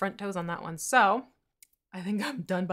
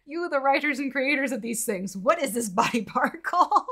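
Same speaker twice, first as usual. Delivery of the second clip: louder, higher, laughing question; 6 semitones higher, 10 dB louder than usual. Real, not acted. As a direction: laugh through the silly question. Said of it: "acting the question laughing".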